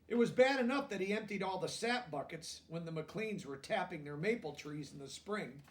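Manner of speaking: lively storybook reading aloud; reading to children. A narrator's reading. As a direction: read it out to the class, bright and clear.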